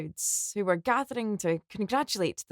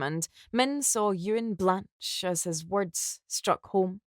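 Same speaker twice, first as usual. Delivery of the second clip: ordinary delivery, nothing marked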